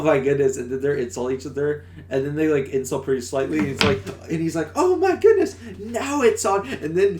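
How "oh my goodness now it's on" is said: very high, loud retold exclamation; hammed-up high indignation; mock outrage. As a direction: squeal it as if a fight is starting. Acts out the cat's outraged reaction.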